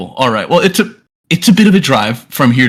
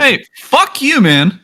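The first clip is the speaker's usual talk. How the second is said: mock angry retort; 12 semitones higher, slower than usual. Friend voicing a rude retort.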